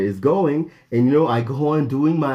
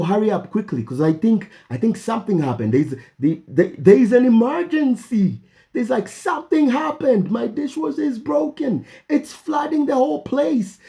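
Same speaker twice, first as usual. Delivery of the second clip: high-pitched urgent plea; urgent call; 10 semitones higher than usual. Retold or imitated. Calling the apartment office about a flood, high urgent plea. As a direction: rush them, it's an emergency.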